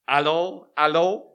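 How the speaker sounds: loud, rising French telephone hello with a laugh; eager schoolboy French accent; hammed-up French accent. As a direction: put on his best French accent, calling out down the line. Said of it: "he spoke in his best French accent".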